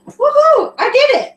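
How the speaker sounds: high, loud whoop of triumph; excited cheer. Real, not acted. Learner told she got it right, real excited cheer. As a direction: celebrate with a big high cheer. Celebrating getting pronunciation right.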